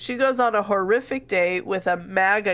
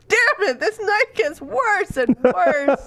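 exasperated; 9 semitones higher, 4 dB louder, slower than usual. Retold or imitated. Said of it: "And she's just like".

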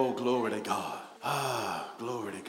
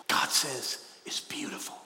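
whisper; 6 dB quieter than usual. Real, not acted. Hushed aside in sermon.

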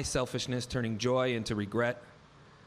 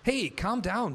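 sharp, higher retold snap at husband; snapping back; 8 semitones higher, slower than usual. Wife snaps back at her frustrated husband, sharp. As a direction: cut him off with a sharp hey. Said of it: "Betty snapped back".

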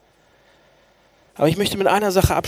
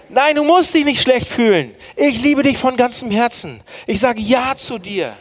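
higher, insistent voice of God's reassurance; emphatic affirmation; 6 semitones higher than usual. Acted. Preacher voicing God telling people he loves them, emphatic affirmation. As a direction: press each promise home with raised pitch.

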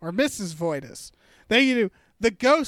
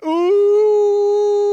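excited ooh; 12 semitones higher, 9 dB louder than usual. Real, not acted. Reacting to epic slam.

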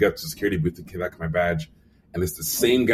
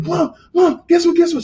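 excited calling; 7 dB louder than usual. Retold or imitated. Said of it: "I'm like".

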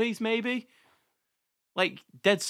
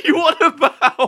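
laughing; 10 semitones higher, 7 dB louder than usual. Real, not acted. Both cracking up at list.

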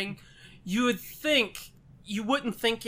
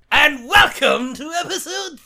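announcer welcome; 4 semitones higher, 6 dB louder, slower than usual. Acted. Intro shout.

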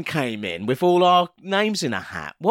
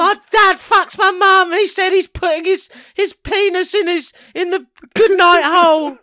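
put-on much higher, louder kid voice; loud cheeky little kid; child voice; 12 semitones higher, 6 dB louder than usual. Voicing a child on TV.

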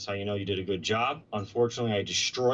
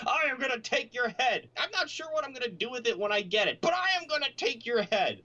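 much higher, hammed-up threatening boast; mock orc bravado; 12 semitones higher than usual. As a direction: play the clueless brute making a grand threat, pitched up. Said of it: "I was like".

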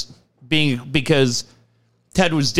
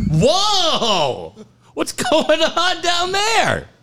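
much higher, slow, startled stage voice; mock surprise; much slower than usual. Host reacting to a heckler from the crowd, hammed-up surprise. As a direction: act shocked at the outburst.

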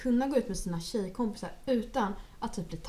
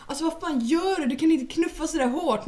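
higher, louder mock-offended guy; 5 semitones higher, 4 dB louder than usual. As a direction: play the hurt ego, indignant. Killen blir kränkt. (kränkt kille, högre och starkare)

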